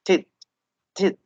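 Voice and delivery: ted said apart, repeated; ted ending. Shown as a demonstration. Teacher splitting visited for students. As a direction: stress the ending ted as its own syllable. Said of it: "we say visited".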